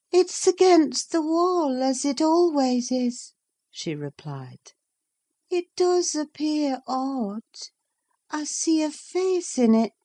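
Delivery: put-on high, dreamy sick young woman's voice; lost in a fevered vision, talking to her nurse; feverish Catherine. A narrator's reading. Catherine insists on the press.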